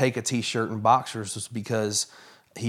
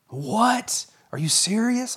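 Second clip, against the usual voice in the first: retold shock; 11 semitones higher, slower than usual. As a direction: react like a stunned friend hearing the news. Retold friend's shocked reaction.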